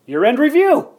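bright eager announcement; excited exclamation. Retold or imitated. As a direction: sell it like a big idea. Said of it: "And I thought to myself".

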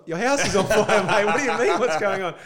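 loud, high incredulous yell; exasperated laughing shout; retold shout. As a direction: shout it at him, half laughing. Yelling to alert a homeowner.

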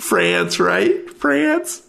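clerk, rising puzzled. Acted. Clerk guessing the accent.

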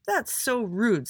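high, drawn-out indignant snap; snapped. A narrator's reading. Frankie scolds little Andy, snapping. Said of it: "snapped Frankie".

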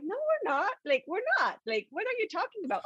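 high, fast, indignant little assistant; personal assistant voice. Retold or imitated. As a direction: squeak it out like a put-out secretary.

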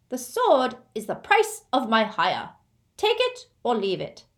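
proud, insistent goblin; indignant goblin. Acted. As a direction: press a grievance and lay down terms. Goblin claims sword, sets terms.

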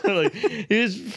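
laughing. Real, not acted. Laughing after Mario bit.

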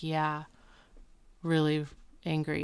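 low, soft, halting admission; mock-glum reply, voice dropped; subdued confirmation. [name] admitting Will got angry, subdued retold reply.

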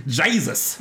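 put-on Texan accent. Imagined Texan walks in on Druids, Texan accent. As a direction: burst in as a mock Texan. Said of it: "Texan just walks in with a shotgun".

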